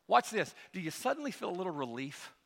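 ordinary delivery

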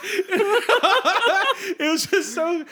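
high words breaking into real laughter; laughing hard. Real, not acted. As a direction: try to talk while cracking up. Cracks up at retold insult.

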